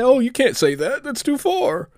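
high, whiny mock complaint; mock whining. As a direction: pitch way up and whine like a sore loser. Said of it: "I don't get to say".

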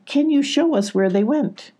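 high, eager request; huge smile. A narrator's reading. Sylvie asking the goats for directions, smiling and eager. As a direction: ask eagerly through a big smile. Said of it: "said with a huge smile".

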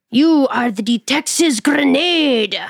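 put-on slow, loud Nigerian-accented proclamation. Fan after a tent show hails her in a Nigerian accent. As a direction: boom it out in a thick Nigerian accent. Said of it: "He's got a thick Nigerian accent".

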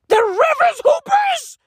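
high, loud disbelieving yell; incredulous shout. Real, not acted. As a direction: yell it like you can't believe it. Incredulous yell at team name.

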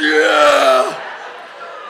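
drawn-out high mock wail; hammed-up uncontrolled sob; imitated bawling. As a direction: wail loudly like a big man crying. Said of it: "everything is engaged".

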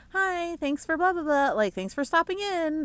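high, singsong customer-service voice; put-on retail hello, pitched well up; rote shop greeting. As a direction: chirp it like a sales-floor script.

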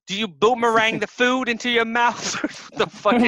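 put-on Australian insult, laughing at the end; mock Australian accent. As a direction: taunt in an exaggerated Australian accent. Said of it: "to this Australian".

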